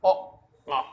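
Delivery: ओ, आ drawn out loudly as sounds; teacher sounding out the vowel ओ, आ; vowel sound demo. Shown as a demonstration. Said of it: "जो sound निकल रही है वो अ से".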